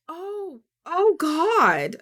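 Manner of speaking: real fright, caught off guard; startled gasp. Real, not acted. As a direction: jump at a sudden scare. Startled by the clip.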